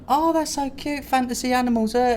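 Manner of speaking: ordinary talk, nothing marked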